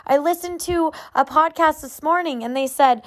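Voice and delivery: ordinary delivery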